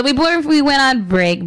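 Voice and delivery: hurried radio host delivery; rushed. Real, not acted. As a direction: rattle it off a little fast. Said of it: "I know I can talk a little fast".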